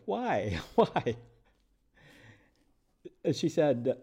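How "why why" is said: higher, drawn-out mock-dismayed why; exaggerated inner pleading; inner-voice protest. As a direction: whine the why, half laughing. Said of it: "In my mind, I was like".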